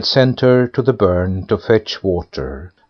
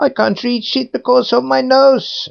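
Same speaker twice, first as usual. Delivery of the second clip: put-on much higher, whining girl with a huge nose; whiny daughter; 14 semitones higher than usual. A narrator's reading. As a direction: complain like a spoiled girl. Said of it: "said the daughter".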